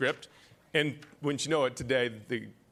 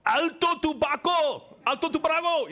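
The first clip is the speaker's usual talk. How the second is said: much higher, louder Spanish command; yelling in Spanish; 12 semitones higher, 3 dB louder than usual. Retold or imitated. Coast Guardsman yells at narco sub crew, high loud Spanish command. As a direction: bark the Spanish order at the hatch.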